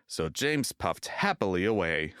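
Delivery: plain reading voice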